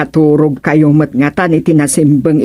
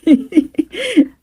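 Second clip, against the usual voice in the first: a bright, high laugh at the irony; high burst of laughter; 10 semitones higher than usual. Acted. Neighbor laughing that the complainer got complained about, high laugh.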